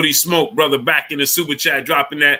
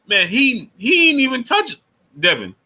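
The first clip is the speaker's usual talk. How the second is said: incredulous exclaim; 9 semitones higher than usual. Real, not acted. Amazed at the fight.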